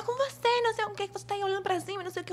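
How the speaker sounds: high-pitched, hurried imitation of scolding; mock scolding producer. Producer telling off the model for looking up, mock high-pitched scolding. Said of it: "essa mulher gritava".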